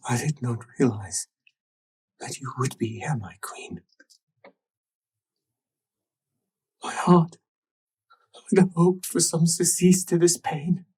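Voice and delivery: hushed, near-whispered emotional confession; tearful reverence. Acted. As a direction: plead softly, as if through tears. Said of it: "looks up at you with tears in his eyes".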